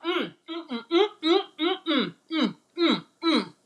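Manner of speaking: high happy mm-mms; rhythmic yummy mm-mm; savouring hum. Real, not acted. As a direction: hum over a tasty bite. Savouring the food.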